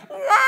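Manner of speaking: loud, very high crying scream; mock infant shriek; imitated baby wail. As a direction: wail like a baby getting a needle.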